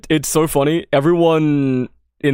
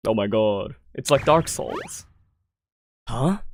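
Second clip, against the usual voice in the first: usual speaking voice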